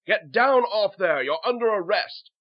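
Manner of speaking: loud, high shouted order; guard's barked arrest. Acted. Guards arresting people in story.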